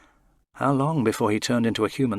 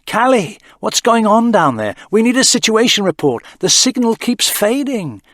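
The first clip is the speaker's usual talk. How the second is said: voiced higher, urgent radio call; Dana over the comms; 11 semitones higher, 9 dB louder than usual. A narrator's reading. Dana calling Callie from the ship, urgent. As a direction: call from the ship, a little strained. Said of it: "Dana's voice crackled through the ether".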